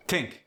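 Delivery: "Tink" with a hard T for TH; Cajun tink. Shown as a demonstration. Teaching Cajun TH as T, "Tink". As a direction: say "Tink" with a plain T, no TH. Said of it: "gets the T instead".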